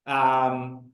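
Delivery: ordinary delivery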